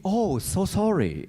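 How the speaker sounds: high, slow, overly polite sorry; bow out with a simpering apology; mock meek apology. Picturing bowing out of the agent's office, mock meek apology. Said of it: "my response was either gonna be".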